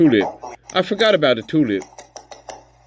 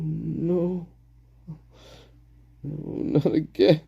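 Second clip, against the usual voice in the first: despairing character; 3 semitones higher, 4 dB quieter, much slower than usual. Acted. Pleading with Maisie as it happens again, despairing. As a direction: lament it slowly, building to a plea. Acts out dreading the same thing.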